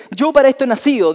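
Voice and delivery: a preacher voicing Christ's bold declaration; Christ proclaiming. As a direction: declare it with full authority.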